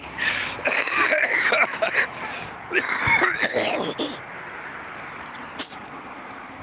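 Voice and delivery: high wheezy laugh breaking into coughs; long, helpless wheeze and cough; wheezing laugh into coughing. Real, not acted. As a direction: crack up until you cough. Cracks up at own joke.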